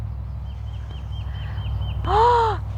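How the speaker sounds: sharp breathless gasp of shock. A narrator's reading. Narrator gasping as the cone drops, breathless gasp. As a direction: gasp as if watching it fall.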